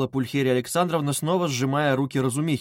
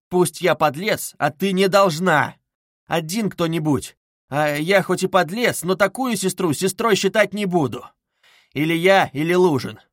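slow, higher, emphatic declaration; raised-voice ultimatum, words drawn out; effortful ultimatum; 5 semitones higher, 5 dB louder than usual. A narrator's reading. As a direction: summon last strength to insist. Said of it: "собрав последние усилия".

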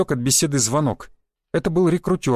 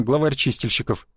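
plain reading voice

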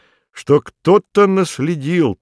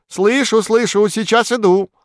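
высоко и громко, откликаясь; кричит, будто издалека зовут; крик кролика; 8 semitones higher than usual. A narrator's reading. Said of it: "крикнул". (кролик кричит в ответ)

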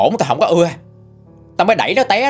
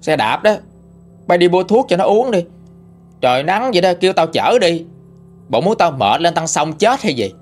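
irritated scolding; 5 semitones higher than usual. A narrator's reading. Mother-in-law refuses, scolding.

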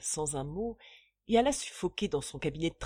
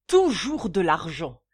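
much louder, hammering toujours; enraged creditor shouting; furious shout; 10 dB louder than usual. Acted. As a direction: explode at him. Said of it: "clama Bonnaire".

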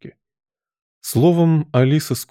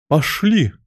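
raised, higher-pitched order; roared command; 6 semitones higher than usual. A narrator's reading. Queen leading off to croquet, roaring. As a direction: call them onward imperiously. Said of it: "взревела королева".

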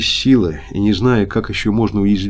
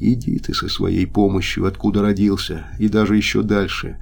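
plain reading voice

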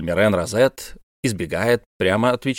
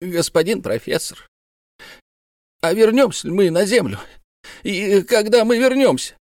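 trembling voice; 10 semitones higher than usual. A narrator's reading. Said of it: "спросил его дрожащим голосом".

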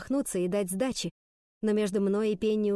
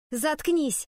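angry retort; 6 semitones higher than usual. A narrator's reading. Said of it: "а затем зачастила".

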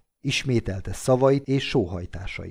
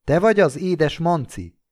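plain reading voice

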